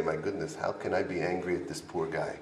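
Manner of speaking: soft, low murmur to oneself; hushed compassion. Acted. Madrich thinks kindly of the poor guy, hushed and compassionate. Acts out compassionate inner thought.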